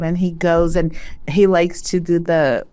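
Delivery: ordinary talk, nothing marked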